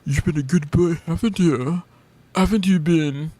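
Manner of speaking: lightly hammed-up good-boy baby talk; teasing cooing praise. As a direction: coo it like a doting owner. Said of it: "maybe you're into the whole, like".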